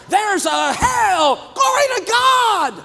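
high, slow, hammed-up shouting churchgoer; mock a delayed amen shouter; late amen shout. Church member shouting amen too late, hammed-up shout. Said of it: "five seconds behind".

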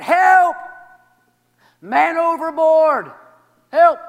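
loud, high cry for help. Acted. Man overboard shouting for help. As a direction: yell it out over the water. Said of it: "the man cried".

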